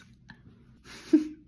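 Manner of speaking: breathy guilty laugh. Real, not acted. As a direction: let out a sheepish laugh. Laughing at own cruelty.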